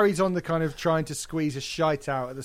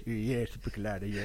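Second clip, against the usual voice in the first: lower, softer, dopey agreeing voice; low mock backing voice; 6 semitones lower, 7 dB quieter than usual. Podcaster voicing a bandmate chiming in, low and dopey. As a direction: mumble it like a bored bandmate. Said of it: "and someone goes".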